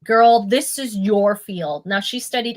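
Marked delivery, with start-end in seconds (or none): none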